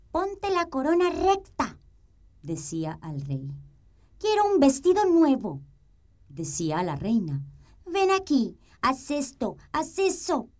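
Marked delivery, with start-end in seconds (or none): 0.1-1.8 high, bossy little princess; cartoon princess. Bossy princess ordering king. 4.2-5.6 high, bossy little princess; cartoon princess. Bossy princess demanding dress. 7.8-10.5 high, bossy little princess; cartoon princess. Princess ordering palace servants.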